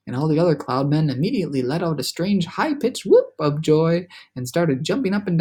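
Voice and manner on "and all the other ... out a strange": ordinary delivery, nothing marked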